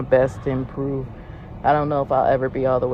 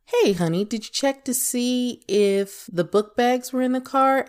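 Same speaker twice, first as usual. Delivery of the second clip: higher, lilting check-in with a partner; sweet tone of voice; 7 semitones higher than usual. Shown as a demonstration. Asking honey about the book bags in the car, higher friendly tone. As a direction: lift the pitch into a friendly, open question. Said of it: "That's tone of voice".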